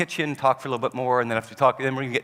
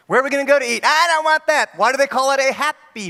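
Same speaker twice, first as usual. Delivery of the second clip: high, louder mock kid whine; whining child; 10 semitones higher, 8 dB louder than usual. Child refusing dinner choice, high whiny voice. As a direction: complain like a picky kid.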